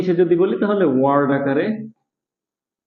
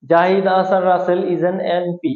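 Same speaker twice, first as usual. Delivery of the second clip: ordinary talk, nothing marked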